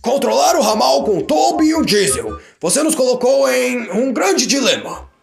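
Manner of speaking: autoritário, alto e lento; Sir Topham Hatt. Acted. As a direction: fala firme de chefe. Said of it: "disse Sir Topham Hatt". (Sir Topham Hatt ao Percy, firme e alto)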